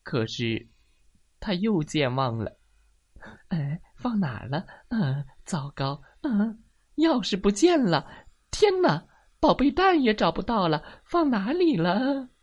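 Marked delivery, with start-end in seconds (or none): 3.5-12.3 worried mumbling building to high, loud alarm; acted panic. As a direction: panic more and more as a hen who lost everything. Hen panics over lost items.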